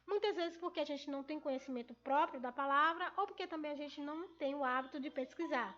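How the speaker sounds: normal talking voice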